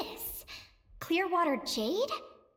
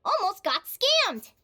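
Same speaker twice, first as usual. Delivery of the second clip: indignant exclamation; 3 semitones higher, 6 dB louder, slower than usual. Acted. Diary entry about near scam.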